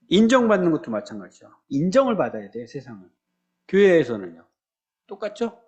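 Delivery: normal talking voice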